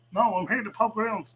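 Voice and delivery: lightly put-on nosy intruder's protest; insistent gatecrasher. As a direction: imitate a party crasher refusing to go. Gatecrasher refusing to leave.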